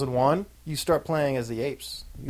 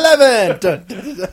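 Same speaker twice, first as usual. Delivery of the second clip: high booming shout breaking into laughter; shouted announcer; 9 semitones higher, 9 dB louder than usual. Retold or imitated. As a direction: bellow the number, laughing. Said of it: "Where it's just like".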